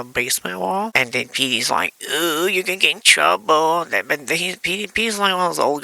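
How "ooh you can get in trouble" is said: drawn-out, higher mock warning; imitated singsong taunt; teasing. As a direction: tease like a tattling kid. Petey teases about trouble.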